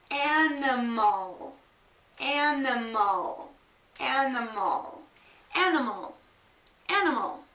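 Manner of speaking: animal stretched slowly, every syllable clear; slow drill. Shown as a demonstration. Teacher to students, modeling animal at half speed. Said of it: "teacher drilling the word slowly".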